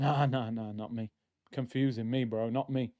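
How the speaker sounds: low, soft brush-off; deflecting denial. Acted. Streamer denying to a gym stranger that it's him, low deflecting denial. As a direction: play it down, dropping pitch and volume.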